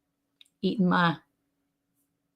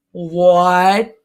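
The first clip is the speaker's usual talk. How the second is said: loud stunned What?; shocked what; 6 dB louder than usual. Retold or imitated. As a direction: react in total disbelief. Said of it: "I was like".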